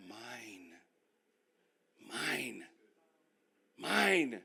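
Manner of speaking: three mines from a murmur to a shout; possessive mine. Acted. Repeating mine, growing possessive.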